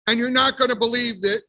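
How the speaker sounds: ordinary delivery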